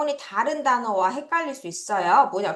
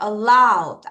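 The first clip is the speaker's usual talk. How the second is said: "Allowed" with a louder, drawn-out LOWED; stress demonstration; 3 semitones lower, 5 dB louder than usual. Shown as a demonstration. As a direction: punch the LOWED in "Allowed", then fall. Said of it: "앞에다 강세".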